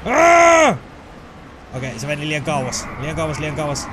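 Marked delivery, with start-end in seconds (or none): 0.1-0.8 sudden loud cry of alarm; startled yell. Real, not acted. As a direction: yelp as if caught off guard. Startled in game. (säikähtänyt huuto)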